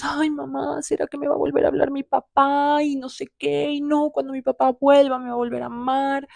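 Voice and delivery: lightly voiced hopeful girl asking mom; whiny teen girl. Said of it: "en mis quince años".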